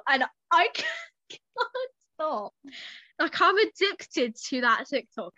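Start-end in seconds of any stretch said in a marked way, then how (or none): none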